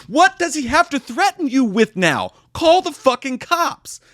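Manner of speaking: high-pitched incredulous demand, slowing at the end; exasperated outburst. Acted. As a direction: hammer it home to a clueless heroine. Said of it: "And I'm like".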